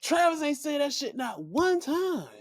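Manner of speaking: high, slowish mock-outraged voice; exasperated rant. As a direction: scold him in high disbelief. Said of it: "I'm like".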